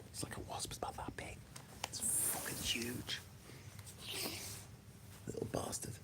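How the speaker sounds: hushed whisper about a wasp. Real, not acted. To viewers while a huge wasp hovers, a hushed whisper. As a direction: whisper so the wasp won't hear.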